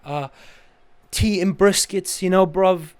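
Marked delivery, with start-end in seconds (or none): 1.1-2.9 put-on slow, louder cockney-style British accent; mock British accent. Teasing a British-looking friend in loud mock British accent. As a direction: ham up a British lad saying bruv. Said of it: "you got that accent".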